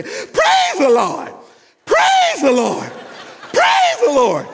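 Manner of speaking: very high, drawn-out holler; screaming praise. Acted. Mother dragged down the aisle by ushers, screaming praise. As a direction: scream it like someone being hauled out. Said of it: "kicking and screaming".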